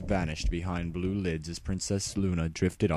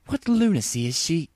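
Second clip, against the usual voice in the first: indignant outburst; 10 semitones higher, 4 dB louder than usual. Acted. Luna exclaiming in outrage.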